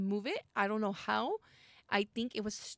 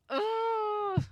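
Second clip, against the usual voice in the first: anguished groan; 13 semitones higher, 5 dB louder than usual. Real, not acted. Hard choice.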